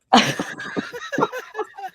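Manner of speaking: breathy high-pitched laugh; shrill giggle fit; high squealing laugh. Real, not acted. Laughing at the claim.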